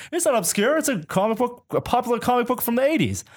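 very high-pitched imitation of indignant protest. Costumed stranger corrects the photographer, imitated indignantly. Costumed man objects to being called obscure.

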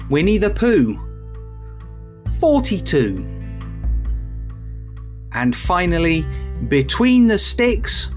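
sing-song bingo number calls; bingo caller. Acted. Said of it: "eyes down, here's the answer".

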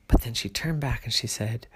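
plain reading voice

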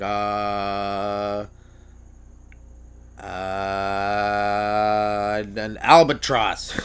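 lightly voiced, slow groping for a name; hesitant golfer. Golfer stalling for a bird name, slow and unsure. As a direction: hunt slowly for a word.

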